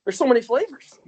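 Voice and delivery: excited, rising to a high peak. Retold or imitated. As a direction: gush about the espresso. Imitating excited coworkers.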